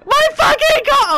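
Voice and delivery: loud furious yell; screaming. Real, not acted. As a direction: yell at the loss. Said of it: "the way you just screamed".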